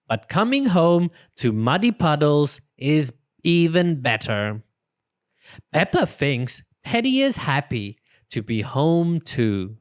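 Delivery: ordinary delivery, nothing marked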